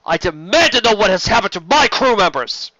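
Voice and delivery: loud, high indignant demand; furious prisoner demanding answers; angry demand. Acted. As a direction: demand it as a captive. Sheridan demands about crew.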